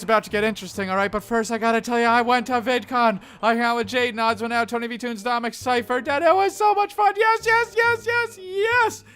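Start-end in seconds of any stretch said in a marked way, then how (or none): 6.2-9.0 very high, squealing yeses; overjoyed vlogger squealing yes; gushing excitement. Real, not acted. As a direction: squeal with delight. Gushing about VidCon.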